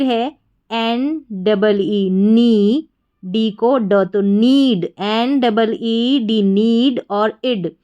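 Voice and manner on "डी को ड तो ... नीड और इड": ordinary talk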